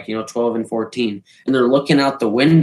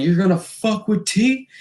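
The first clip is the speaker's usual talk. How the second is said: pitched-up mock challenge; retold challenge; 8 semitones higher than usual. Friend charging in to defend him, mock challenge. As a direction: imitate a friend rushing in to defend. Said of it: "all I hear him saying".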